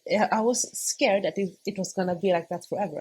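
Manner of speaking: normal talking voice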